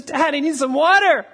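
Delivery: mock kid begging dad for water; imitating thirsty son. Retelling his son Ethan home from gymnastics, pleading.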